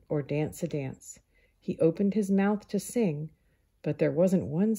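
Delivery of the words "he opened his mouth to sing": plain reading voice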